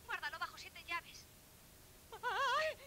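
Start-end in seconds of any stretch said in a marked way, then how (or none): 2.2-2.8 startled cry. Acted. Sudden cry of alarm.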